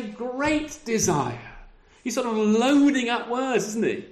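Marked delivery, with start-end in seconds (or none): 0.0-1.4 "Great desire" drawn out very slowly with weight on "Great"; emphatic. Shown as a demonstration. Preacher stressing Paul's words, "Great" slow and weighty. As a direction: stretch "Great" to load up the word. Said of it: "What does he say?".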